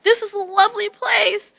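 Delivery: high, lilting lovely; sweet mock delight. Showing off her new home, high sweet coo. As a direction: coo it like a happy tourist. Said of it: "was like".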